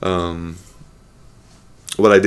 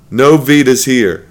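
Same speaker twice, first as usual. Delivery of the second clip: louder, higher, drawn-out jab; pissed-off rant; 4 semitones higher, 6 dB louder, slower than usual. Real, not acted. Host rubbing it in to Vita fans, pissed off. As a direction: declare it slowly and defiantly. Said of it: "I'm still pissed about that".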